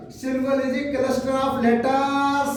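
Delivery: syllable is a cluster of letters sung out slowly; slow sing-song dictation. Shown as a demonstration. Teacher to students, slow sing-song dictation. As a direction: chant the definition slowly for the class. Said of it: "teacher dictates definition slowly".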